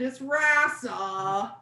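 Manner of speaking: drawn-out homely "Rasa", no rolled R; Cleveland pronunciation. Retold or imitated. Comic deflating someone's exotic fantasy, slow American "Rasa". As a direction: stretch "Rasa" slowly in everyday American vowels. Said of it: "you're just back here in Cleveland".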